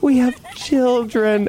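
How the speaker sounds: high, drawn-out mock sob; retold sobbing. Telling the hypnotist about her kids, hammed-up sobbing. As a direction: wail it slowly, imitating yourself in tears. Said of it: "literally sobbing on her couch".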